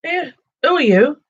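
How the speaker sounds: a high squeak of a talking purse; tiny purse squeaking in alarm; squeaky purse. Acted. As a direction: squeak it out like a startled little purse. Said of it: "it squeaked".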